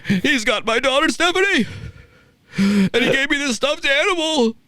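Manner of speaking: high, pleading imitation of a rattled old man; mock Vince pleading. Host imitating Vince telling the cop a wild excuse, high and whiny. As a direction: whine it up high like a panicked old boss. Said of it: "what he said to the cop was".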